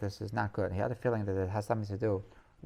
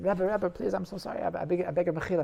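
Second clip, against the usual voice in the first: imitating a frantic, higher-pitched plea; pleading apology; 7 semitones higher, 3 dB louder than usual. Jester apologizing to the crying rebbe, pleading. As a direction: beg urgently for pardon.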